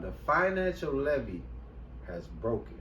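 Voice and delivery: slow and deliberate. Shown as a demonstration. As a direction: spell it out slowly, dropping low at the end. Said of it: "I'ma say it slow this time".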